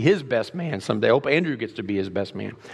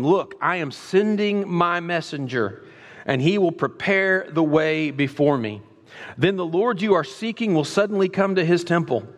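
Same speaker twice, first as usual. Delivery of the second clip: raised, proclaiming scripture voice; scripture reading; 5 semitones higher than usual. Acted. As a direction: read the prophecy with fervor. Reads scripture aloud.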